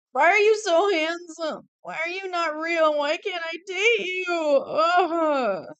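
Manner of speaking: high, loud, drawn-out whine; mock wailing lament. Lamenting to the fictional dream man, hammed-up wailing. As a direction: wail it like a lovesick tantrum.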